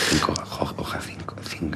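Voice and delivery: barely audible, half-voiceless murmur; whispered muttering. Real, not acted. Puzzling over the hieroglyph clue, whispering to oneself. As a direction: whisper it to yourself, working it out.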